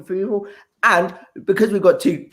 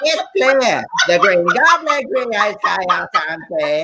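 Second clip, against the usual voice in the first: lightly voiced husky pop singer; 3 semitones higher, slower than usual. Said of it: "I can do an impression of [name]".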